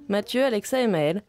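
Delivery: ordinary delivery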